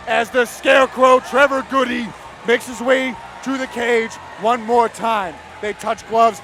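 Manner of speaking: loud, slow, drawn-out hype call; hyped-up commentator shout. Real, not acted. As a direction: build the crowd up for the walkout. Commentator hyping fighter's walkout.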